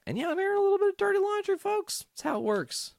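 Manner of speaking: very high, fast, squeaky voice; squeaky aside. Real, not acted. Admitting to viewers he's airing dirty laundry, squeaky.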